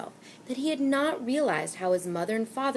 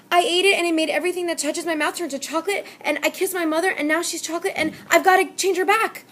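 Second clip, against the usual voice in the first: fast, high, loud babble; babbling; 10 semitones higher, 7 dB louder, faster than usual. A narrator's reading. Panicked boy pours out the story to the shopkeeper, fast and loud. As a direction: tumble the words out in panic. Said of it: "he babbled on".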